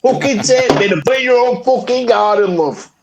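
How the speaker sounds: very high, indignant Yorkshire rant; old Yorkshire man. Acted. Ranting about bins as an old Yorkshire man. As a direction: wail it like an aggrieved old Yorkshireman. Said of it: "That's exactly what I would sound like".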